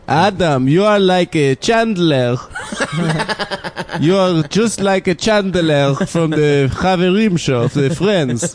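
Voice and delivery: hammed-up Israeli English accent. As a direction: imitate an Israeli cousin with throaty r's. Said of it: "My Israeli cousins are like that too".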